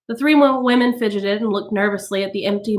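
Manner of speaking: ordinary delivery, nothing marked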